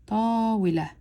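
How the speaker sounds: "ta" held, flowing straight into joined "wi, la"; blended syllables. Shown as a demonstration. Teacher contrasting separate syllables with the blended word. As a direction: lengthen "ta" and run "wi, la" together. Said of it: "but I go".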